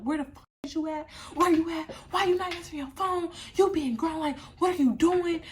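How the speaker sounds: acted angry mom. Mom texting her missing daughter, acted angry and high-pitched. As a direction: imitate mom firing off angry questions. Mother's angry texts voiced.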